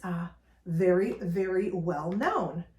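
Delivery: normal talking voice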